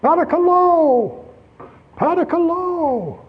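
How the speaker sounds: high, drawn-out beggar's plea; begging cry. Retold or imitated. Preacher imitating a beggar's high pleading call. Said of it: "I heard some guy saying".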